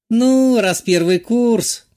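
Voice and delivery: high, slow, knowing tease; sly teasing. A narrator's reading. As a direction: let it trail off slyly. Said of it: "прищурилась блондиночка". (лукаво, с намёком)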